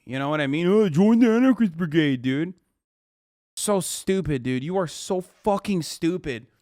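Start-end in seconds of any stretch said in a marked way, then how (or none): 0.7-2.5 sneering mimicry of a naive poster; mock the commenter's eager tone. Streamer mocking a chat poster's call to arms, sneering. This dumbass thinks it's literally Call of Duty.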